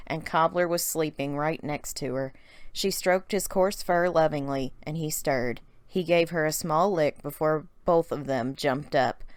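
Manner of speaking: usual speaking voice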